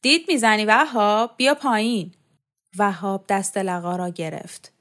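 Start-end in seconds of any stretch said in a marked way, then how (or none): 0.0-2.1 loud, raised call up to someone; shouting. Acted. Shokat spots Vahab at the window and shouts at him. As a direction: yell it up at the one peeping. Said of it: "فریاد کشید".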